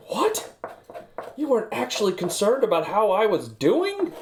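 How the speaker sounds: squeaky high shocked question; offended surprise. Retold or imitated. Acquaintance reacts to the polite greeting with high shocked offence. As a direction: act hurt and astonished. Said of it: "And they're like".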